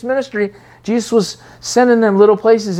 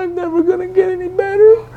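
much higher, put-on whine of despair; mock despair; 12 semitones higher than usual. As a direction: wail it like a hopeless believer. Believer despairing at himself.

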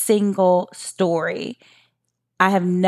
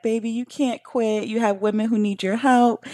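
a light imitation of a tender, encouraging husband; loving, supportive; 4 semitones higher than usual. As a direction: reassure her like a doting husband. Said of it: "so innocent and loving and supportive".

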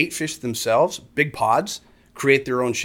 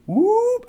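high rising swoop noise; high whoop. Retold or imitated. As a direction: whoop like something zipping into place. Imitates moving the boat in quickly.

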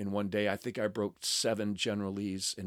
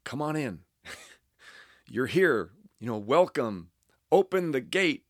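a sign cheerfully calling them in, louder; mock welcoming sign; 3 semitones higher, 6 dB louder than usual. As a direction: ham up the sign's welcome. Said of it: "that sign said".

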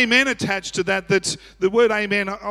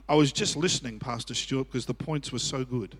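low, very soft mock compliment; retold listeners; 7 semitones lower, 7 dB quieter than usual. People to pastor after the message, softly. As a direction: drop right down, softly imitating a listener. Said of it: "People go".

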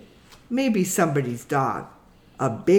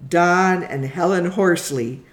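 usual speaking voice

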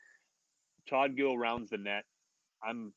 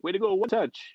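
teasing teammate call; 10 semitones higher, 7 dB louder than usual. Retold or imitated. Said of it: "he's like".

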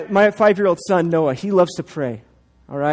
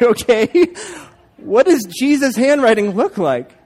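mock curious; 5 semitones higher, 5 dB louder, slower than usual. Dad questions the doubting friend.

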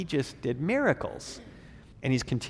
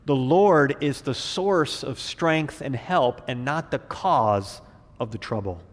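ordinary delivery

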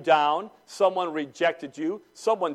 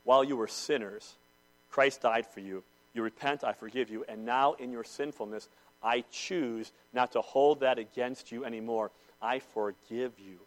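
soft, tender voice of God; lower, softer, forgiving and calm; God speaking gently; 5 semitones lower, 4 dB quieter than usual. Retold or imitated. Preacher voicing God, soft and tender. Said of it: "And God says".

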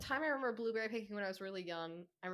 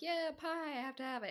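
eager craving; 7 semitones higher than usual. Retold or imitated. Telling friend about holiday pie, mock eager. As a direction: act out keen craving for pie, pitched up.

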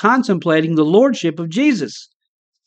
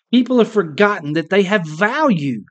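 normal talking voice

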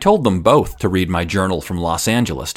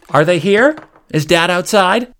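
raised, higher mock call from a mom; mother calling out; 10 semitones higher, 4 dB louder than usual. Mother calling up to her son, raised and high. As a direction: call upstairs like a curious mom.

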